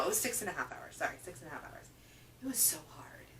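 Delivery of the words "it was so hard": breathy whisper of "It was so hard"; hushed admission that the book was hard. Real, not acted. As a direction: whisper it like a confession. Confessing how hard it was.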